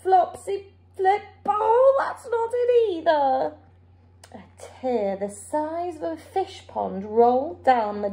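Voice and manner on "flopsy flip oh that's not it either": higher, whiny giant voice; frustrated giant trying again; giant wailing. A narrator's reading. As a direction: giant wailing at another wrong rhyme. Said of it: "the giant wailed".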